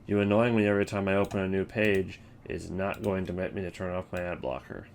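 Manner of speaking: ordinary delivery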